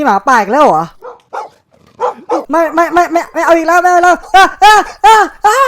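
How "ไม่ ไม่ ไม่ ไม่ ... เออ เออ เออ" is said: high, loud panicked pleading; frantic no no no while running; panicked shouting. Real, not acted. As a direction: beg in panic as the wolves come. Wolves chasing again in game.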